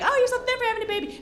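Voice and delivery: gushing stranger. Retold or imitated. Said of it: "They're like".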